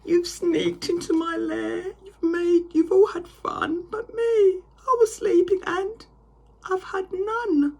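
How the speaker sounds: high loud whining sob; wailing bear.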